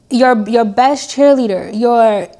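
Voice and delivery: usual speaking voice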